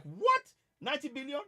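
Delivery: high, loud incredulous gasp; stunned, half shouting the question; shocked disbelief. Retold or imitated. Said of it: "I saw it and I'm like".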